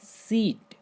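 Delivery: सीट said "seet", with a long ee. Shown as a demonstration. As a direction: model सीट with one drawn ee before t. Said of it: "तो इसको हम पढ़ेंगे".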